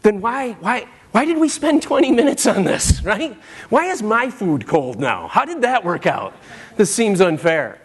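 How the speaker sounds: a parent's mock-indignant inner rant; exasperated parent. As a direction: grumble like a worn-out parent.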